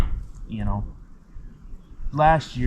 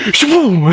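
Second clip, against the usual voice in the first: mock gunshot; 5 semitones higher, 7 dB louder than usual. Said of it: "and I just went".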